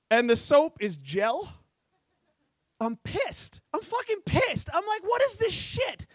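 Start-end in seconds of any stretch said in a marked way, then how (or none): 2.8-6.1 higher, indignant pitch; hammed-up fury; mock outrage. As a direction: rant about the gel soap. Comedian acting outraged in bathroom.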